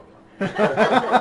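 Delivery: a big burst of real laughter, high and loud. Real, not acted. Telling friends a bar story, bursting into loud laughter. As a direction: crack up loudly at your own joke. Laughing at own dancing joke.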